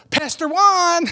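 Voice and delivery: put-on high, loud child's call. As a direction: imitate a little girl hailing someone. Little girl calls out to pastor.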